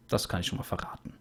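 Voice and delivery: hushed, voiceless whisper; conspiratorial whispered tease; whispered secret. Real, not acted. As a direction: let it slip like a secret aside. Teasing a spoiler secretively.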